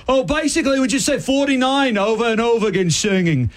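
a hint of an Australian accent on the brother-in-law. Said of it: "I don't know why I'm doing Australian accent".

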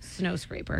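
whispered aside; breathy deadpan whisper. Real, not acted.

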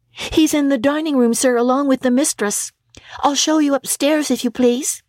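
a shy housemaid showing the way; young servant girl. Acted. Servant girl answers Scrooge.

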